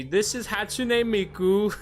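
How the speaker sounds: a badly done British accent. British girl showing him Hatsune Miku, bad British accent. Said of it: "I don't know how to do a fucking British accent".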